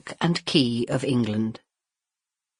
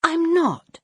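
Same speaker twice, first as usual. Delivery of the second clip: hurt. Acted. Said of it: "Violet said, feeling hurt".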